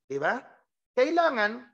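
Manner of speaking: ordinary delivery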